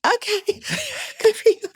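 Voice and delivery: very high, humoring squeak; mock-polite congratulations. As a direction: humor someone in a tiny high voice. Retold reply to stranger's boast.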